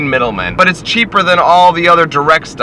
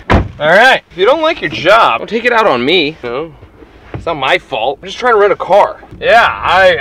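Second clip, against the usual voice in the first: getting heated; 2 semitones higher, 3 dB louder than usual. Retold or imitated. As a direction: let the irritation spill over into a tirade. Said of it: "I'm getting a little heated".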